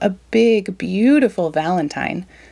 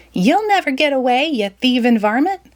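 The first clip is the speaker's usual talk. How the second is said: yelling; 6 semitones higher than usual. Acted. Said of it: "Sandy behind him yelling".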